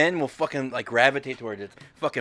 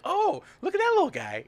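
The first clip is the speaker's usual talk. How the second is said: put-on much higher child's voice; small daughter. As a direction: sound like a toddler. Quoting his small daughter.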